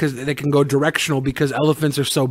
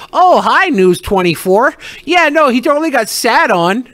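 high, loud chirpy phone-answering voice; sing-song fake cheer on the phone; mock widow; 9 semitones higher, 4 dB louder than usual. Host mocks the widow answering the news call, chirpy. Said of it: "be like".

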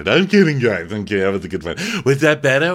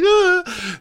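much higher mock wail; mock sob; 5 dB louder than usual. Brandy starting to cry at the table, high mock wail. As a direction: fake a little cry. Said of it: "it's like".

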